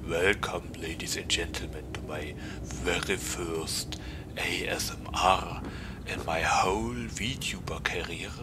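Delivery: soft low ASMR whisper; breathy whispered welcome, close to mic. Pretend. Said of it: "Oh God! I can't do this".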